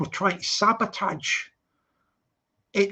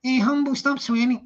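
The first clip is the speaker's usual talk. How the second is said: put-on Scouse accent; 4 semitones higher than usual. Streamer mimicking Expo telling Humble off, Scouse accent. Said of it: "That sounds Scouse".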